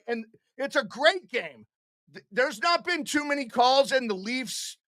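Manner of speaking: ordinary delivery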